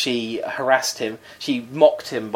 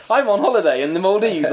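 mocking agent; 7 semitones higher than usual. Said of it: "His agent said".

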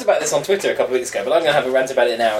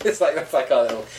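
normal talking voice

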